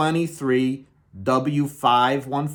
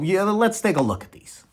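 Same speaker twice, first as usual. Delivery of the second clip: ordinary talk, nothing marked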